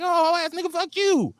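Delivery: high, hammed-up retort; mock comeback. Imitating his reply to a hater insulting his street. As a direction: snap back at the insult. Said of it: "I'd be like".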